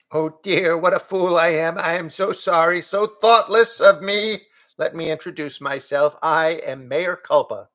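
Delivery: flustered goat. A narrator's reading. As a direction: apologise grandly as the goat. Goat introduces himself.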